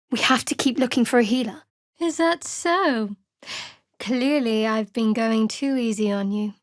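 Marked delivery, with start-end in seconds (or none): none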